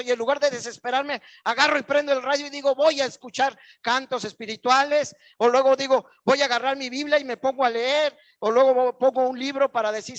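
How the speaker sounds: loud, high preaching voice that keeps building; full-force preaching. Acted. Preacher stirring up the church, preaching at full force. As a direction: preach it out over the congregation.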